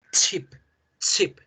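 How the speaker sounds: "chip" with a short explosive t-sh onset; sneeze-like ch. Shown as a demonstration. Teacher models the word for potato chip, sharp ch burst. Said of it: "con la otra hacemos el estornudo".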